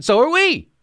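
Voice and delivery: high, sing-song me-too reply; mocking Democrat. Mocking corporate Democrats agreeing with Republicans, high and sing-song. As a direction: chirp it back like a yes-man. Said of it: "that's why they sound like this".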